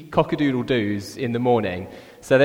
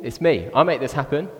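ordinary talk, nothing marked

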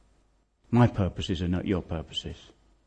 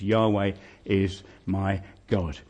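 ordinary talk

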